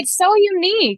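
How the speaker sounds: high, excited praise; gushing. Real, not acted. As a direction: gush over it, voice jumping up. Gushing about host's voice.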